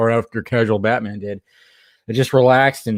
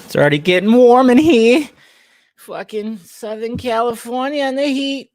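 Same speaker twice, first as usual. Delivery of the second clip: whiny high complaint; 12 semitones higher, 5 dB louder than usual. Real, not acted. Complaining about heat, high voice.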